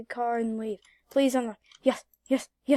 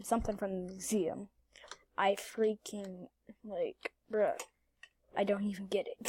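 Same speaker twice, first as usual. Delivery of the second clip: whisper; 4 semitones lower, 10 dB quieter than usual. Real, not acted. Whispering while sneaking in game.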